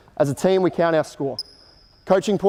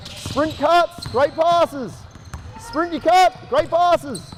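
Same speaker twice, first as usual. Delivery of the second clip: coach calling out; 11 semitones higher, slower than usual. Real, not acted. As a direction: drive the players on from the sideline. Coach calling cues during drill.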